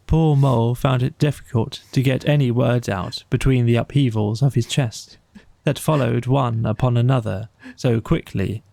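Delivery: ordinary delivery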